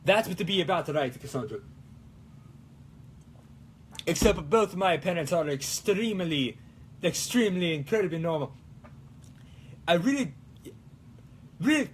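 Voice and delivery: mock Italian accent, lively and sing-song; put-on Italian accent. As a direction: keep up a playful Italian accent. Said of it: "speak in this accent".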